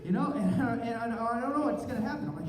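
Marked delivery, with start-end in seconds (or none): none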